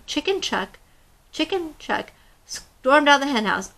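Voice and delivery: put-on expressive read-aloud to children; storybook reading. Teacher reading a picture book to young listeners, expressive. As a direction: tell the tale to a class of kids.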